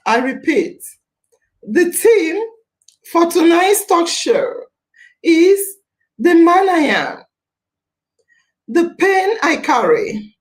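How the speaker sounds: measured oratory with long pauses between phrases; dramatic speech. Acted. Host solemnly introducing tonight's men's rights theme, dramatic speech. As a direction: deliver it phrase by phrase, letting each one land.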